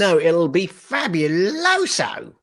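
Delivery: hammed-up, drawn-out showman flourish; playful hamming. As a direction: stretch out the last word, high and theatrical. Playfully tops co-host's "fabulous.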